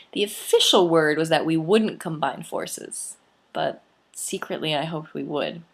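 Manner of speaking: plain reading voice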